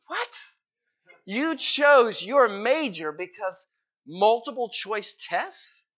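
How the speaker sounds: high, drawn-out mock disbelief; retold incredulity. Reacting to dad choosing a major for multiple choice tests, incredulous. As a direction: question it slowly, as if astounded.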